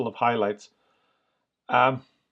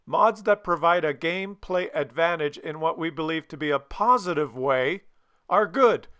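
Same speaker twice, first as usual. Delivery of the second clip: ordinary delivery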